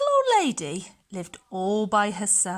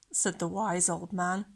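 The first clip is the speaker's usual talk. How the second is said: ordinary delivery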